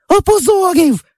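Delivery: put-on high piercing little-girl shriek; childish shriek. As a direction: shriek it like an outraged little sister. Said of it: "раптом як закричить". (пронизливий дитячий вереск)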